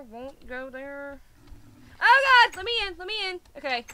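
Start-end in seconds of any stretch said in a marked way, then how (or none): 2.0-3.4 much higher, louder frantic shout; real alarmed yelling at the door; panicked yell. Real, not acted. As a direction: scream to be let in. Locked out, panicking.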